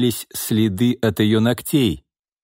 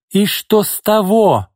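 much higher, louder, slow hysterical scream; hysterical yell; 11 semitones higher, 4 dB louder than usual. Acted. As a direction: shriek it at him, slowly. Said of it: "истерично завопила Орлова".

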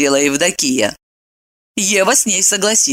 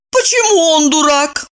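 higher, hurt question; offended; 9 semitones higher than usual. A narrator's reading. Eva defends Bob to her friend, offended. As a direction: ask as if mildly wounded. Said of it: "обиделась Ева".

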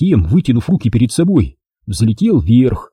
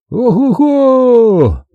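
high, drawn-out "oho-ho" whoop; whooping cry; 12 semitones higher, 3 dB louder, much slower than usual. A narrator's reading. Wilson dives around Jack mid-air, whooping cry. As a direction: whoop long and high in flight. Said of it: "услышал я его крик".

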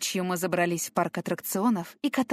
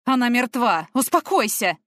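stern shout; 4 semitones higher, 6 dB louder than usual. A narrator's reading. Man shouts at hysterical girl.

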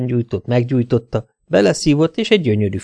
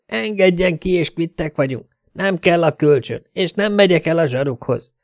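higher voice, bargaining to get out; shut-in captive pleading; 7 semitones higher than usual. A narrator's reading. Szelin bargains with her captor from the locked bathroom, pleading high. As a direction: plead in a high voice from behind a door. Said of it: "szólt Szelim bentről".

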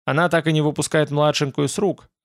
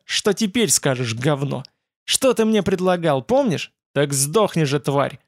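usual speaking voice

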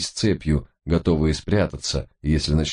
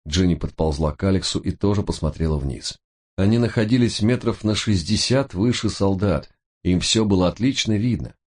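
ordinary delivery, nothing marked

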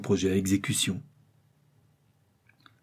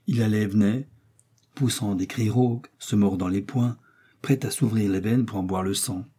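ordinary delivery, nothing marked

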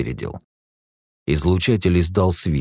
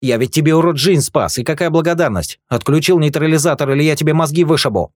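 high, loud angry threat; furious threat; 10 semitones higher, 4 dB louder than usual. A narrator's reading. Rick threatens the guard at gunpoint, high and loud. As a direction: throw it in his face. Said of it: "Бросил ему в лицо Рика".

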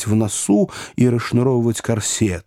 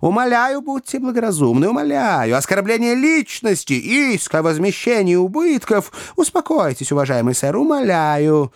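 high, imploring little gentleman; anxious high-pitched appeal; pleading little man; 9 semitones higher than usual. A narrator's reading. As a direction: beg them to calm down in a high voice.

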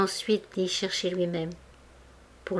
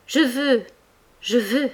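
passionate insistence; 4 semitones higher, slower than usual. A narrator's reading. Tortured Mouret insists to Denise, passionate. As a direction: hammer the words with longing. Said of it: "répétait avec passion".

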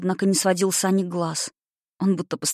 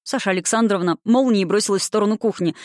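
ordinary delivery

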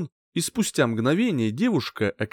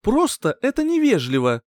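higher-pitched, mildly put-out remark; displeased grumble; 8 semitones higher than usual. A narrator's reading. Girlfriend objecting to her boyfriend's manners, mildly displeased. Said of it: "недовольно буркнула де Гласис".

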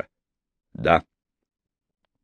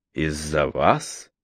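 low, slow, doubtful question; slow disbelief; 6 semitones lower, 5 dB quieter, much slower than usual. A narrator's reading. Man asks in disbelief.

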